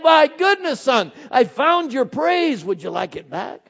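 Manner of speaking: a high, loud, delighted father voice; playful God voice. Retold or imitated. God teasing his son, high and delighted. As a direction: hold out a gift, teasing and joyful.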